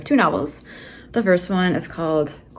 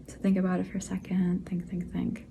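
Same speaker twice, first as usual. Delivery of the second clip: soft playful whisper, much softer; hushed prompt; 9 dB quieter than usual. Acted. Author nudging viewers to ponder the trivia, soft whisper. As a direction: hush down and let them think it over.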